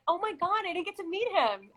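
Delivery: high, lively mock disappointment; excited dismay. Telling Michael how she reacted to his video, mock dismay. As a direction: relive the gutted fan moment, lightly.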